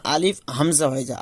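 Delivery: ordinary talk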